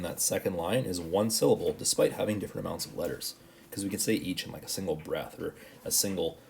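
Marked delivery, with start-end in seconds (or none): none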